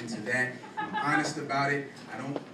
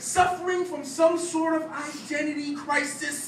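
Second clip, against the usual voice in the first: higher, louder, rhythmic slam-poem delivery; spoken-word poetry; 7 dB louder than usual. Acted. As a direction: perform it as a punchy stage poem.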